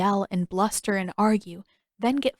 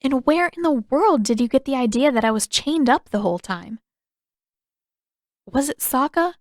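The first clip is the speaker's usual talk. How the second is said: higher, louder accusing question; suspicious Katara; 5 semitones higher, 5 dB louder than usual. Acted. Katara interrogating Aang about Sokka, suspicious and raised. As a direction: press him for the truth.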